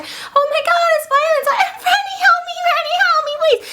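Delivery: high squealing damsel, half laughing; mock panicked plea. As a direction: beg for rescue in hammed-up terror. Elizabeth begs for rescue.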